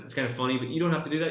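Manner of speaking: ordinary delivery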